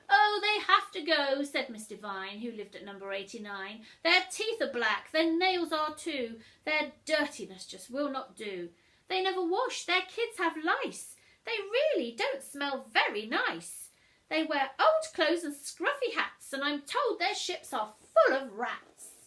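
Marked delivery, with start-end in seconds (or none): 0.1-1.4 shrill, loud outraged lady. Acted. Miss Devine demanding the pirates leave, outraged. 4.0-19.1 loud, high disgusted lady; snobbish neighbour. Acted. Miss Devine listing the pirates' faults, disgusted.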